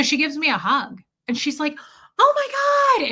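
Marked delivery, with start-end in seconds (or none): 2.2-3.1 high delighted squeal of greeting; excited squeal. Retold or imitated. Retelling a shop owner's greeting, high excited squeal. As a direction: squeal it like a friend thrilled to see you. Friend greeting with hug, retold.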